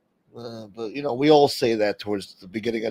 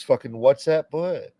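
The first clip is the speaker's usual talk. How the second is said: ordinary talk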